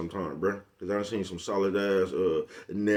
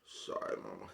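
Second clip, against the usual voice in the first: breathy whispered sorry; sheepish whisper to mama; a hushed apology to his mother; 13 semitones lower, 16 dB quieter than usual. Real, not acted.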